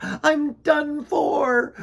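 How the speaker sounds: high, loud, very slow wail of despair; crying in fear. A narrator's reading. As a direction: wail it like it's the end. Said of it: "he cried in fear".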